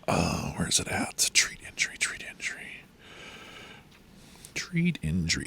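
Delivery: whispering to oneself; whispered muttering. Real, not acted. Game master hunting for the treat injury rule, whispering. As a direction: mutter it under your breath, barely voiced. Searching the rules, muttering to self.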